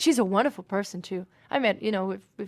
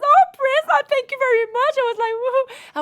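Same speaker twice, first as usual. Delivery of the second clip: squealing high and loud; gushing fan; 11 dB louder than usual. Retold or imitated. [name] gushes at [name] as a starstruck fan. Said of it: "The fan came out of me".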